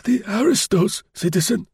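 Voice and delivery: put-on high, puzzled old voice; bewildered old man. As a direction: play dumb as the old concierge. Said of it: "queried Grosjean".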